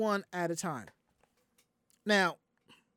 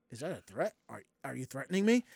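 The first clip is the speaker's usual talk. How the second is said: Is that a threat; 7 semitones lower, 4 dB quieter, faster than usual. Retold or imitated. Confronting the screaming customer.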